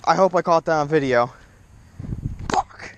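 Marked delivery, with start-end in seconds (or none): 2.5-2.9 breathless whispered swear; voiceless frustrated curse. Real, not acted. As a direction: hiss it under your breath. Lost the fish.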